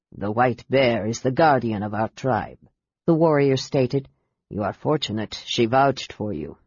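put-on deep, low, rumbling accented chieftain; rumbling mountain spirit. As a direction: rumble like an avalanche. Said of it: "His voice rumbled like an avalanche".

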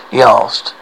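ordinary delivery

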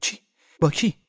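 startled high cry of alarm. A narrator's reading. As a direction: jump at the words in fright. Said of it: "ماری وحشت زده از جایش پرید".

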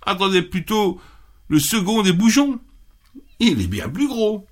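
high, small begging voice; pleading little goat. Acted. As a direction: beg for mercy in a tiny voice. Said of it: "dit le bouc".

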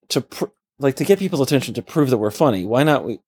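normal talking voice

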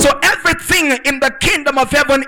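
fired-up preacher driving each phrase home; full-force preaching. Real, not acted. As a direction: declaim it to a congregation, forceful and sustained.

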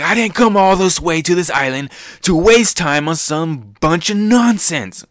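a cool swordsman brushing off a waste of time; Zoro's clean line. Retold or imitated. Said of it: "And he's like".

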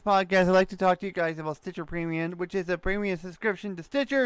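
breeze through the promo like a host read; quick ad read. Acted. Reads sponsor ad before podcast.

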